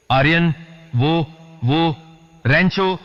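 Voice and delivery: high, halting cry of shock; alarmed cry. A narrator's reading. Khushboo suddenly spotting Rancho lying there, alarmed cry. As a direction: stammer it out high, with drawn-out gaps. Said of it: "अचानक खुशबू चिल्लाई".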